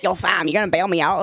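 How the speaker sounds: put-on Toronto slang accent. Client greeting a lawyer far too casually, mock Toronto accent. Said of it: "you're talking like that".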